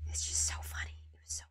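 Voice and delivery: near-whisper, barely voiced; hushed aside. Real, not acted. Amused at the whole situation, hushed aside. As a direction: say it very softly under your breath.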